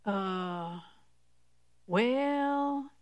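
drawn-out, unsure hedging; softly stall, as if faith is wavering; doubtful hesitation. Acted. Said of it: "you're like".

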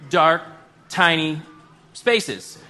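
dark, tiny, spaces, each word set apart by a pause; dramatic pauses. Acted. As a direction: land each word separately for comic weight. Comic callback with slow pauses.

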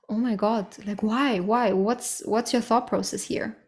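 ordinary talk, nothing marked

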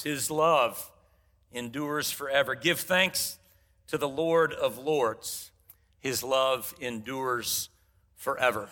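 slow, low solemn reading; scripture reading. Acted. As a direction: intone the psalm slowly and reverently. Reads psalm responsively.

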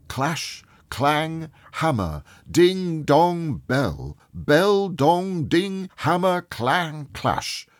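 ringing, sing-song bell words; imitating pealing church bells; bell imitation. A narrator's reading. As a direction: ring out each word like a bell.

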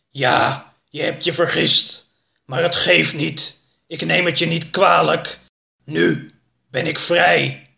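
growled, weighty tiger voice; growling tiger house. A narrator's reading. Tiger house forgiving Oroka before breaking free, growling. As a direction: growl each phrase out like a tiger. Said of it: "gromde de muil".

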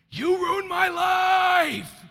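put-on sudden, very high, drawn-out scream of rage at the baby; retold furious scream. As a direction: let it burst out like a volcano of exhaustion. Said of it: "I was screaming".

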